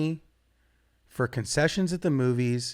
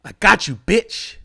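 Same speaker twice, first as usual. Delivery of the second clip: parting insult; 4 semitones higher, 6 dB louder than usual. Retold or imitated. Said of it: "And then he goes".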